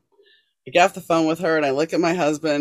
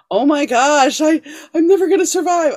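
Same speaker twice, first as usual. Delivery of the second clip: high, loud melodramatic wail; mock teen despair; 11 semitones higher, 6 dB louder than usual. Teen after a breakup, higher and louder, mock despair. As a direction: ham up a heartbroken teenager. Mocking a heartbroken young teen.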